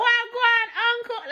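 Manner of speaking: high-pitched Jamaican Patois greeting. Retold or imitated. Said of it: "I was chatting patois".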